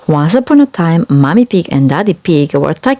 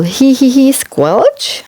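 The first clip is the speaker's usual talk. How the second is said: giggle and squelch; 9 semitones higher, slower than usual. Acted. Playful sound effects in story.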